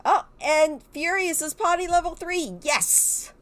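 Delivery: high, happy little victory yelp; real delighted yes; excited cheer. Real, not acted. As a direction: celebrate the win. Potty skill hits level three.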